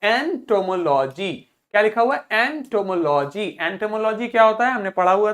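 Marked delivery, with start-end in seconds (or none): 0.0-1.3 एंटोमोलॉजी drawn out syllable by syllable; pronunciation demo. Shown as a demonstration. Teacher asks class to say it along, slow, measured एंटोमोलॉजी. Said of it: "मेरे साथ में बोलिए". 2.3-3.6 एंटोमोलॉजी in slow, clear syllables; pronunciation demo. Shown as a demonstration. Teacher answers what is written, slowly sounded-out एंटोमोलॉजी. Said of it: "मेरे साथ में बोलिए".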